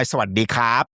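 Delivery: "Sawadee krap" in a native Thai accent; native Thai greeting. Shown as a demonstration. Teacher showing a natural Thai greeting. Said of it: "my ... is more natural".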